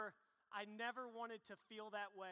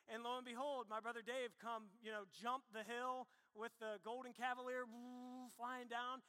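ordinary talk

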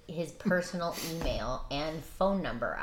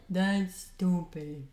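slow mocking quote; much slower than usual. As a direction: drag it out slowly, mocking him. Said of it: "the whole lecture he gave me on".

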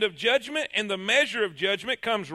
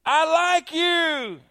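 high, loud, slow; echo calling back; 7 semitones higher, 6 dB louder, much slower than usual. Acted. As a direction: echo the kindness. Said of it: "He hears".

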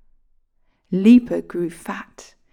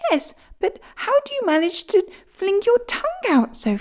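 much higher, curious bird voice; sparrow voice; 12 semitones higher than usual. Acted. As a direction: ask like a puzzled little sparrow. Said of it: "asked the sparrow".

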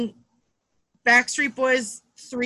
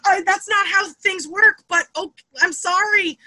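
high-pitched, apologetic imitation of mother; exasperated mom, acted out; flustered mom impression; 9 semitones higher, 3 dB louder than usual. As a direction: protest in a high voice, then apologize. Flustered mom answering accusation.

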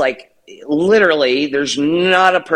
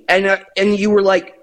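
normal talking voice

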